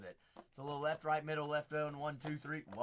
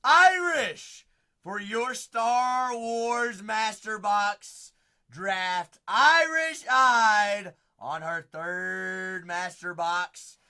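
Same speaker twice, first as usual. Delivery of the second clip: booming slow sports announcer; draft announcer; 6 semitones higher, 13 dB louder, much slower than usual. Acted. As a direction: announce it like a big draft pick. Mock NFL draft pick announcement.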